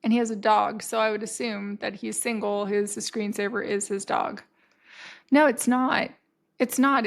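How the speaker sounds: ordinary talk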